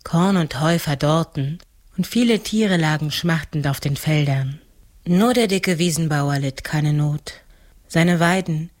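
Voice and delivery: usual speaking voice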